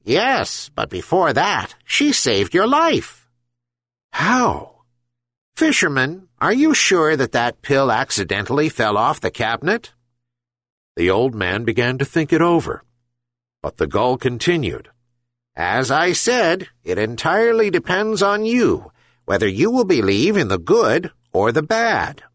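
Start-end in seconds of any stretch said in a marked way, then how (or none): 0.0-9.9 high, wise bird voice; gull voice. Acted. Herring gull speaking. 15.6-22.2 gull voice. Acted. Gull continues.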